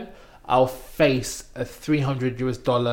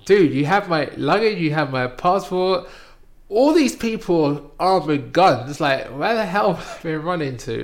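higher, louder exasperated protest; retold annoyed retort; 5 semitones higher, 6 dB louder than usual. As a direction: throw the absurdity back at him. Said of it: "just really annoyed me".

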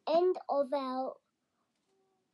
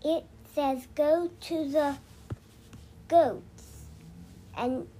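put-on reading to a toddler. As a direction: lull a little one to sleep. Child guiding a sleep story.

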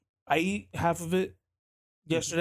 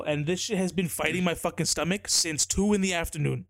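normal talking voice

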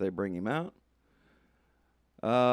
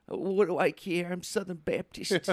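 mock character voice; 8 semitones higher than usual. Acting out a made-up reply.

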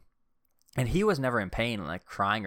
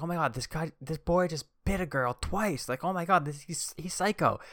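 freaked-out adult; 9 semitones higher than usual. Retold or imitated. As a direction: ham up the shocked grown-up. Adult voiced freaking out over bite.

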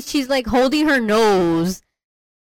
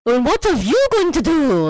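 higher, put-on European actress voice; strong European accent; 7 semitones higher than usual. Actress changing her voice, imitated European accent. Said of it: "European actress, like, voice, like, accent".

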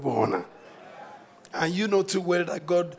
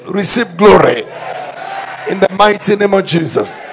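preaching at full force; 2 semitones higher, 10 dB louder than usual. Acted. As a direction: thunder it over the congregation.

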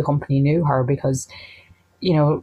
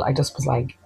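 ordinary delivery

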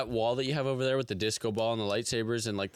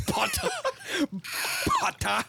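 high, spat-out meme impression; Draco Malfoy. Recalling a meme for co-host, high Malfoy impression. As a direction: throw out the name in a high mock voice. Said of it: "just looking at Draco Malfoy's picture".

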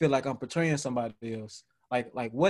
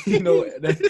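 laughing; 5 dB louder than usual. Real, not acted.

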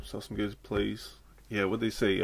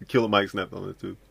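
usual speaking voice

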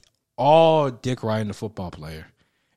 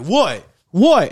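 loud, high, aggressive shouts of what; angry yell; 9 semitones higher, 10 dB louder than usual. Retold or imitated. Football player yelling at him over the ball, loud angry what. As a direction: bark it out like he wants a fight.

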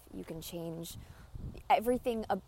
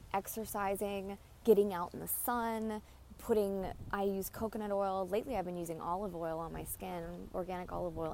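ordinary talk, nothing marked

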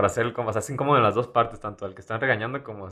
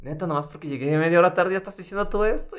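higher exasperated protest; annoyed teen; 8 semitones higher than usual. Retold or imitated. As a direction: whine back at mom, fed up. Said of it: "Y tú así como de".

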